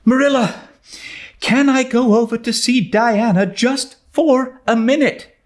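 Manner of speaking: put-on high, breathless girl's voice, pleading; a bright young girl begging to go out; young Anne, high and eager. A narrator's reading. Anne's voice in the story.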